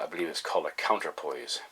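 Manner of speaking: ordinary talk, nothing marked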